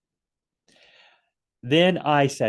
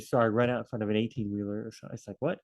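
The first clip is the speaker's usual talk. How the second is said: usual speaking voice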